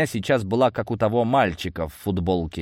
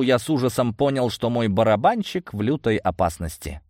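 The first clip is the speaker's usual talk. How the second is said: ordinary delivery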